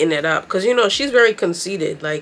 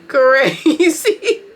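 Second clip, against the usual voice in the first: laughing; 7 semitones higher, 3 dB louder than usual. Real, not acted. Laughing at the gaslighting.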